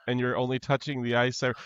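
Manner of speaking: ordinary talk, nothing marked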